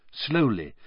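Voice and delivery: plain reading voice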